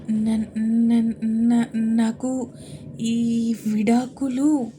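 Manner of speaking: halting, slow broken words; nervous stammer. Acted. Wife stammers nervously before the whole family. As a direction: stumble over each start, scared to say it.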